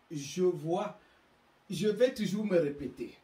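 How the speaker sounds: ordinary delivery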